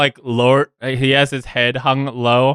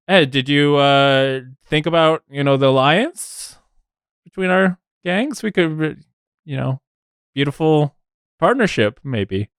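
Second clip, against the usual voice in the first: higher, coaxing salesman voice; wheedling gnome; 5 semitones higher than usual. Acted. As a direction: sweet-talk him into the alliance. GM voices gnome pitching alliance.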